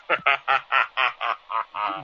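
mocking villain's laugh; gloating laugh. Killer laughing over his coming leisure.

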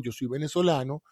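normal talking voice

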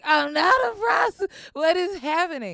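retold shouting back at the cop; indignant shout. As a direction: yell it in shocked denial.

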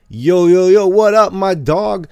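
high, loud fake street-slang greeting; Quentin Tarantino. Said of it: "Just acting like a fucking idiot".

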